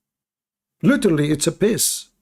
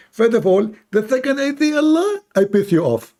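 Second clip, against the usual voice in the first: high, mocking put-on voice; mocking the apologist in a high put-on voice; 9 semitones higher, 3 dB louder than usual. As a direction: play a smug apologist, pitched way up.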